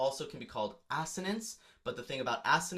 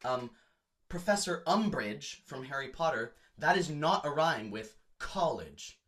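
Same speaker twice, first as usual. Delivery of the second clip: usual speaking voice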